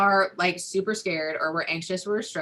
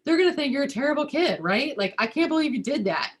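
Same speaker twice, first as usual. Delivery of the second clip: high, loud frantic worry, much higher pitch; panicked parent; 9 semitones higher, 5 dB louder than usual. Retold or imitated. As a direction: voice a parent spiralling in fear at her child. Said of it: "if my kid acts out and I go".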